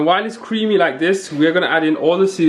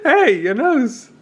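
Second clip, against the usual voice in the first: laughing; 10 semitones higher, much slower than usual. Real, not acted. Laughing at flour on nose.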